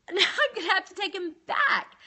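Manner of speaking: high, mock-tearful grumble; hammed-up reluctant resignation; reluctant whine. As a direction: complain to God in a put-on whine. Acts out reluctant complaint to God.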